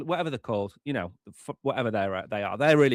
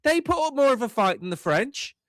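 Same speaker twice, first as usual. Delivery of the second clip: excited rant; 8 semitones higher than usual. Real, not acted. Mocking rant about French military.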